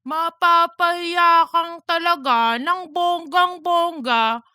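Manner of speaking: mocking, slow put-on voice reading a post aloud; reading like a fool as Cheese. Duga reads Cheese's chirp aloud to Darren, in a mocking slow voice. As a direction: ham it up like a fool, dragging the words. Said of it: "magbasa si Duga ng parang tanga".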